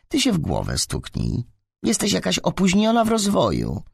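high-pitched, indignant schoolgirl; indignant telling-off. A narrator's reading. Małgosia hits back at teasing about glasses, indignant telling-off.

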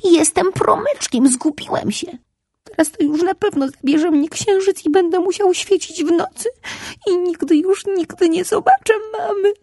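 put-on high little boy near crying; crying for his lost mother; tearful little boy. A narrator's reading. (wysoki, płaczliwy głos malca)

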